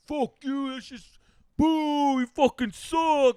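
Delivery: high-pitched, loud heckler's jeer; mock heckling. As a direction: imitate a heckler calling out at comics. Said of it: "he just started talking shit to the comics".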